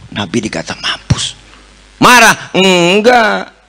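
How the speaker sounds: usual speaking voice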